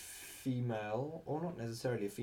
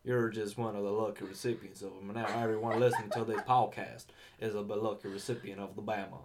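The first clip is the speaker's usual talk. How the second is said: put-on Alabama accent; 7 dB louder than usual. Podcaster explaining his running joke to co-host, Alabama accent. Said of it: "I've been making the fucking Bama joke".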